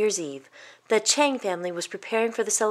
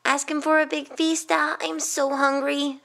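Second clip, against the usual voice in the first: put-on higher-pitched boy's voice, lingering on the end; hungry boy; 7 semitones higher than usual. Middle boy begging his brother for a feast, high and pleading.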